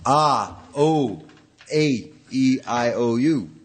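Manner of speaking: sung-out vowel demo; open-mouthed vowels. Shown as a demonstration. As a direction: fill the mouth with each vowel. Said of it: "filling your open mouth with a big".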